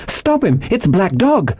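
put-on high, urgent boy's alarm; excited high-pitched call; crying out. A narrator's reading. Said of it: "I cried".

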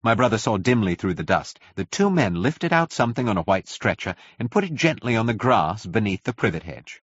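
usual speaking voice

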